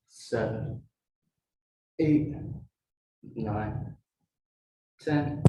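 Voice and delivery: counting reps mid-row, under effort; doing rows. Real, not acted. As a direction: count while rowing, breathing through it. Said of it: "one more set of twenty".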